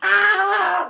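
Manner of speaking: put-on a little old lady screaming in fright; old lady's shriek. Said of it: "this little old lady living in there goes".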